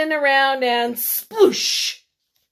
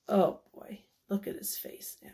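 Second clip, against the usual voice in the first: whispered aside; 4 semitones lower, 11 dB quieter, faster than usual. Real, not acted. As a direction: lean in and whisper. Reader's hushed aside to children.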